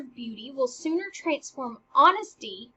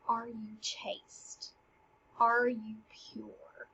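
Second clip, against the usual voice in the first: slow; 3 semitones lower, 8 dB quieter, much slower than usual. Retold or imitated. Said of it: "Honest also meant".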